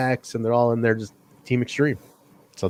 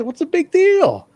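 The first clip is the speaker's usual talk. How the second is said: high, slow mock protest; drawn-out defensive retort, imitating; pushing back; 4 dB louder, slower than usual. Wrestler questioned about hanging out with the enemy, pushing back. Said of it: "kind of pushes back".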